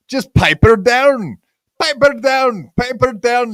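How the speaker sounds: high, badly faked Scottish accent; attempted Scottish accent. Host joking with the ladies, bad Scottish accent. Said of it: "No, that's my Scottish accent".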